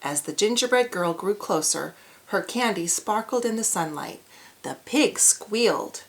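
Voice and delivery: ordinary delivery, nothing marked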